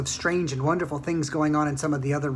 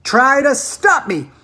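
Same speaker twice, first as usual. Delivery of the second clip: defiant; 8 semitones higher, 10 dB louder, much slower than usual. A narrator's reading. Sick lady defiant.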